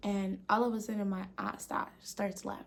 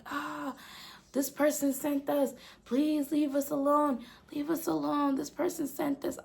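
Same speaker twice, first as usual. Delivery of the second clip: pleading jinn voice; 6 semitones higher than usual. Retold or imitated. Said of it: "They're like".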